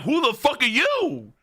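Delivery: high-pitched incredulous demand; shocked disbelief. Retold or imitated. Reacting to a man's changed look, shocked outburst. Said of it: "I'm like".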